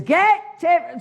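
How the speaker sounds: high sharp shouted command; mock-bossy high yell; barked order. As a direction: snap it out like a bossy neighbour. Woman barks order, retold.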